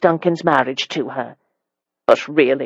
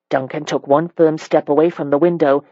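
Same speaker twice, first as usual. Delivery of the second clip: ordinary delivery, nothing marked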